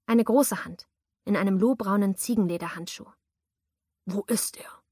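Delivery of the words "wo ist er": threatening hoarse whisper; menacing whisper. Acted. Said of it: "verlangte der Mann bedrohlich".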